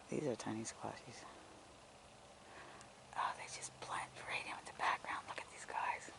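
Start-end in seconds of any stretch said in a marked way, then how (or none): 3.2-6.1 breathy hushed whisper, soft. Real, not acted. Vlogger whispering to viewers about hidden squash. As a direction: whisper so as not to scare them. Hushed while watching creatures.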